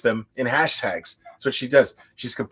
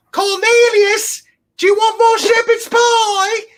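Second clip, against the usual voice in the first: high, loud grandma calling down the basement stairs; shrieking granny calling, slow on the name; grandma yelling; 9 dB louder, slower than usual. Retold or imitated. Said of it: "Grandma's all, like, yelling down the stairs".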